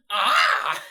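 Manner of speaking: sudden loud, high yell; mock scream. Bird reacting to passerby's hello, mock scream. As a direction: imitate a startled man's full-voiced scream.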